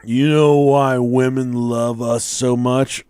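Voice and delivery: put-on slow drunken musing; drunk brother. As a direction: imitate a drunk drawing out a question. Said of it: "he was drunk and fall".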